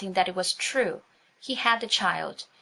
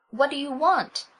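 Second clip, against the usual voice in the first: high, squeaky frightened question; 7 semitones higher, 4 dB louder than usual. A narrator's reading. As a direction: squeak it out in alarm. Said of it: "Cousin Bet's voice was quite squeaky".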